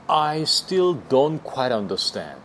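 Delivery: very slow, every word spaced; teacher modelling slowly; slow demo. Shown as a demonstration. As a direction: spell it out for learners. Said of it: "이렇게".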